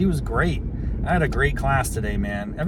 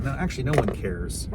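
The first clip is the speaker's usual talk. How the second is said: ordinary talk, nothing marked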